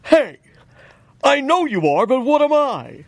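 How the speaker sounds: lightly voiced puppet doing Rodney Dangerfield; a hint of Alf as Rodney Dangerfield. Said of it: "Alf doing Rodney Dangerfield".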